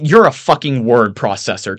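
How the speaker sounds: loud interrupting outburst; blurting out. A narrator's reading. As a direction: cut in with a blurted insult. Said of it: "Tim blurts out".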